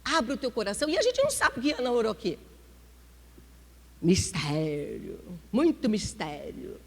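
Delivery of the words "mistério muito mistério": mysterious. Real, not acted. Dramatic pause on mystery.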